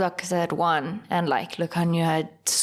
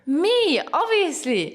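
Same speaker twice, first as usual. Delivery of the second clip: smug; 10 semitones higher, 3 dB louder, slower than usual. Real, not acted. Owning the questions.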